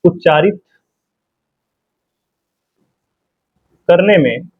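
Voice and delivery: words spaced out with long pauses for writing; slow dictation. Shown as a demonstration. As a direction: dictate slowly so students can write. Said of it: "इसमें बता दे रहे हैं आपको". (रुक-रुक कर लिखवाना)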